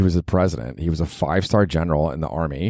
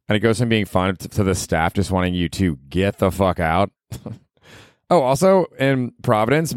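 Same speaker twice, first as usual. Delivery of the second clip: normal talking voice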